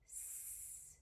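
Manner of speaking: a hissed s, no voice; S as a long unvoiced hiss; isolated s sound. Shown as a demonstration. Teacher isolates the final sound S, hissed. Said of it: "What sound did you hear".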